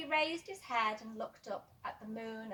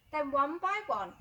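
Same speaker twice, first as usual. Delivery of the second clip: plain reading voice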